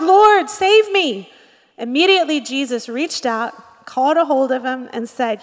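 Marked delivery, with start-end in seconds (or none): 0.0-1.3 loud, high, drawn-out cry for help; retold shout. Preacher voicing Peter sinking in the water, retold shout. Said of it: "And he shouted".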